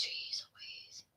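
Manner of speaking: very soft, voiceless exclamation; whispered aside. Real, not acted. Vlogger grumbling to self about cabinet space, whispered. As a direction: whisper it under your breath.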